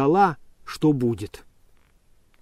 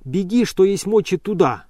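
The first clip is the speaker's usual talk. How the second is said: higher, calling after her; 6 semitones higher, 4 dB louder than usual. A narrator's reading. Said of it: "кричал он ей вслед".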